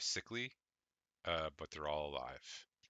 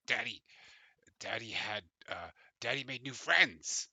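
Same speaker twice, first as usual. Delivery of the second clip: kobold father voice; 6 semitones higher, 5 dB louder than usual. Acted. GM voices Urzink to his daughter.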